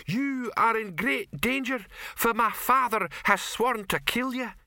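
high damsel voice. Retold or imitated. Troll retells heroine's line.

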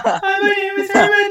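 high strained zombie voice. Pretend. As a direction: groan it out like a zombie. Put-on zombie self-intro.

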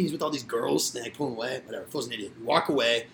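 usual speaking voice

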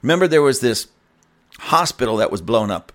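normal talking voice

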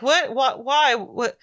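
high-pitched bewildered stammering; mock flustered disbelief; freaking out. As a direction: imitate a panicked kid's string of questions. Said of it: "he's, like, freaking out".

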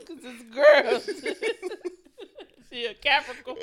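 loud, high laughing. Real, not acted. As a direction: laugh through the punchline. Laughing punchline.

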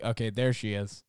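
dull shrug of a greeting; flat, unimpressed. Shown as a demonstration. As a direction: say it like it barely matters. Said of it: "It was more like".

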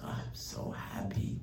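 slow, soft, tearful whisper; father crying. Retold or imitated. Father seeing the report card, crying softly. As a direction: choke it out through tears, slow and hushed. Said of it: "he starts to cry".

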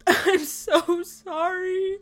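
put-on high, slow, wailing kid apology; mock crying. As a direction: imitate a crying kid, drawn out. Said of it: "kid come in crying".

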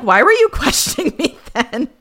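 high, loud incredulous outburst through a laugh; mock indignation. Host imagining snapping at Munch, high and incredulous. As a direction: fire it back in playful disbelief.

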